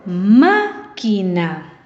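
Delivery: "Máquina" very slow, MÁ stretched and stressed; stress on first syllable. Shown as a demonstration. Teacher repeating for pupils, "Máquina" with exaggerated stress on MÁ. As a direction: draw out MÁ, then set "quina" apart in "Máquina". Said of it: "En la antepenúltima sílaba".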